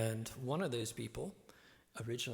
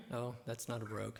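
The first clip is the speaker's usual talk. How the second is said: ordinary delivery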